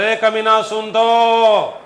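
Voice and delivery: hammed-up shout at the lazy body part; mock yelling. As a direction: bellow it like a cheeky bus body. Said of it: "तू चिल्ला चल बोला".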